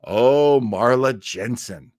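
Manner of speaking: normal talking voice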